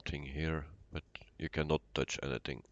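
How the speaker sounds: usual speaking voice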